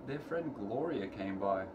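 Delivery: ordinary delivery, nothing marked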